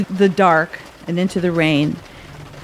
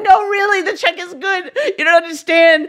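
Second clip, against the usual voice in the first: weeping and begging; 9 semitones higher than usual. Retold or imitated. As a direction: plead through tears that the check is good. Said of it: "I just start weeping and begging".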